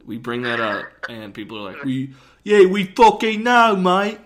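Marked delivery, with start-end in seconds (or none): 1.9-4.2 loud, high, mock-indignant Cockney; Cockney accent. Listeners writing in that they already know, shouted Cockney accent. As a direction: yell it like a fed-up Cockney listener. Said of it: "I can do a good Cockney accent".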